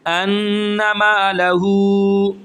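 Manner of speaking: انما لہو with the وہ held long; slow chant, last vowel drawn out; stretched madd. Shown as a demonstration. Teacher shows madd munfasil, slow long vowel. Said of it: "ہم کھینچ کر پڑھیں گے".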